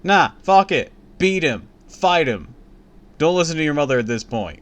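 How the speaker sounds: loud, high, rushing the last words; fired-up outburst. Real, not acted. Reader egging the character on, loud and fired-up.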